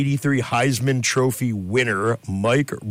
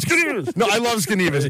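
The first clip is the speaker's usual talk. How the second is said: lifted high, teasing and light; high playful tease; 9 semitones higher, faster than usual. Pretend. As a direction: sing out the silly word playfully. Playful mock pronunciation banter.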